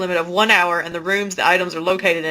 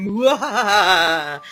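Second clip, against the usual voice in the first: mad scientist laugh; much slower than usual. Pretend. Playful evil laugh after intro.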